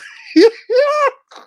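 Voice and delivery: high loud squealing laugh; burst of laughter. Real, not acted. Reacting to a troll's username, high shrieking laugh. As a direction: crack up at the name. Caught off guard by a name.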